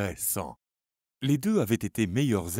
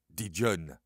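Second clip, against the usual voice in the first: ordinary delivery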